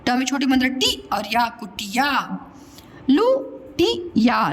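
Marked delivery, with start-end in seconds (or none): 3.1-4.5 लुटिया spoken slowly, short u; लुटिया with a short u, drawn out; slow stretched. Shown as a demonstration. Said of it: "लिख दिया ऐसा गलती से".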